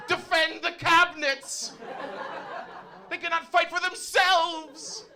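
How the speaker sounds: very high, drawn-out cry of alarm; slow, high-pitched mock distress; mock worried panic. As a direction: cry out in hammed-up desperation. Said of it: "I was just as worried".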